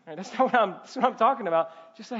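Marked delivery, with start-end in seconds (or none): none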